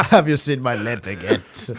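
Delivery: mock French accent. Friend tops the joke about the French teacher, mock French accent. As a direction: fake French accent with a silly added le. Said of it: "spoke with a French accent".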